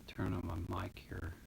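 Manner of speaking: whispered aside while adjusting. Real, not acted. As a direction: say it under your breath. Muttering while adjusting mic.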